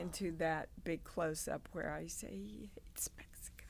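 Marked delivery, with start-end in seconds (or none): 2.3-3.6 much softer, breathy hushed line; tearful whisper. Retold or imitated. Mary in the close-up about her son's words, hushed whisper. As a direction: barely breathe it out, close to tears.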